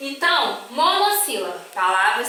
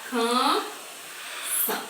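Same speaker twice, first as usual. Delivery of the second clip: "canção" split slowly into can, são; very slow, separated syllables in "canção"; 7 dB quieter, much slower than usual. Shown as a demonstration. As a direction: pause between CAN and ÇÃO in "canção". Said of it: "Vamos separar".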